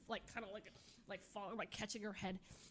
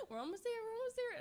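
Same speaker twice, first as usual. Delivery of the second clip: fast, higher, urgent reassurance; hurried reassurance; 10 semitones higher, faster than usual. Retold or imitated. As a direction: rush the words to calm her down. Friend reassuring while driving.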